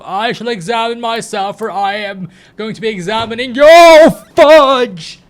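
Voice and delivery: high proclamation building to a yell; mock-grand declaration. Mock-grand reply, then spills water.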